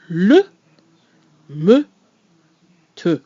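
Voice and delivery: Le, me, te with rounded pure e, loud and clear; French example words. Shown as a demonstration. Teacher reading example words aloud. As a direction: say each word crisply with rounded lips. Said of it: "example words".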